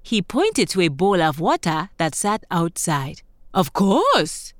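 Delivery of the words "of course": lightly voiced toad at normal pitch. A narrator's reading. Toad agreeing to wash for grasshopper host, lightly voiced toad. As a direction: answer as the toad, just a hint of character. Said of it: "Toad said".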